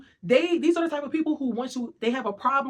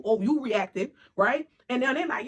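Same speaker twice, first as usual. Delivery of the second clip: normal talking voice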